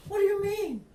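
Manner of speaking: high, loud outraged rant with half-laughs; raving indignation. Retold or imitated. Reporter ranting at the base commander, high and loud. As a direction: storm at the commander in mock fury. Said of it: "I started raving and ranting".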